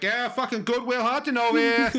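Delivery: high Boston heckle; exaggerated Boston accent. Family fucking with him, exaggerated Boston accent. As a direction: jeer in a broad Boston accent. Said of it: "really exaggerating the Boston accent".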